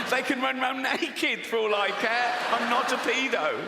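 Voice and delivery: high, insistent mock denial; over-protesting man. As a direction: protest far too much, pitch climbing high. Imagined man protesting too much.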